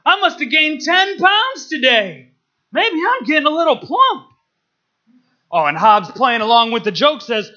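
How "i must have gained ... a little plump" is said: Calvin screams. Retold or imitated. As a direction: shout it out, playing the kid hamming it up. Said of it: "Calvin screams".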